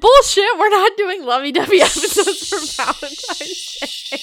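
loud laughing denial; laughing protest. Real, not acted. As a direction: laugh while calling it out. Protesting Valentine's episodes, laughing.

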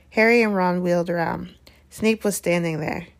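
usual speaking voice

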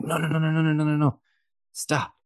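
high-pitched rapid run of no's, then a stop; mock frantic steward. Stewards stopping him driving out, in a mock frantic high voice. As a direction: imitate a steward panicking to block the car. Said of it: "They were like".

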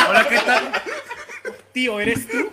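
laughing greeting. Real, not acted. Laughing greeting near mic.

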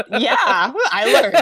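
laughing it out, a little breathless. Real, not acted. Guest laughing at her own snow trip story, laughing. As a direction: giggle through the admission.

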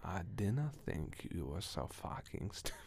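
slow, quiet Scottish brogue; soft-spoken Scottish put-down; Scottish accent. Acted. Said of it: "have to do a Scottish accent".

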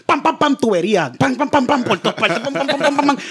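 high rhythmic pam pam; machine pounding noise. Retold or imitated.

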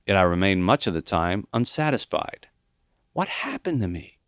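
usual speaking voice

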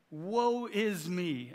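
slow, long-drawn lament; crying out in woe. Acted. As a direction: draw out WOE as a prophet's cry. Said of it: "I cried".

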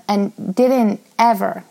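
ordinary talk, nothing marked

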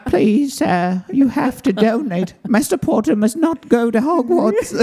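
high, slow pleading house-elf; little elf voice. Pretend. Host voicing a pleading house elf asking for donations, high and slow. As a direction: squeak like a timid house elf. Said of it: "He's like a little elf".

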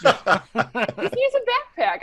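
flat Chicago A in backpack; slow Chicago-accented protest. Real, not acted. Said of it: "said that in the most Chicago way".

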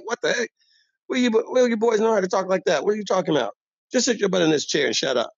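high, incredulous telling-off; exasperated scold. Retold or imitated. Old barber scolding the youngin in a high incredulous voice. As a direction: scoff at the kid's order.

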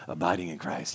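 hushed, sugary "Abiding in Christ" mocking emotional faith; mock dreamy piety. Shown as a demonstration. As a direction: breathe "Abiding in Christ" like a swooning feeling. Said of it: "We say it like that".

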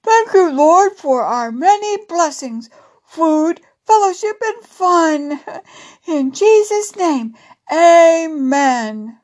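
high, loud character voice in prayer; bear saying grace. A narrator's reading. Coco giving thanks before supper, high and loud prayer. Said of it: "Coco prayed".

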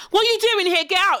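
a British homeowner demanding the intruder leave; acting out a homeowner confronting an intruder. Podcaster playing a homeowner challenging an intruder, mock indignant. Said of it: "we're like".

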